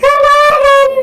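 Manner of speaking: the proud little bird brags about its father; chirpy bird voice. Acted. Bird character talks.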